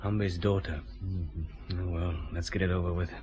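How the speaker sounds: low, soft, reluctant aside; subdued private murmur; muttering to oneself. Acted. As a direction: brace yourself quietly for an unwelcome meeting. Alone, bracing for a hard meeting.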